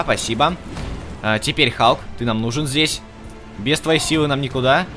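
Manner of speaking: ordinary delivery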